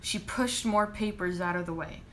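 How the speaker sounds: ordinary delivery, nothing marked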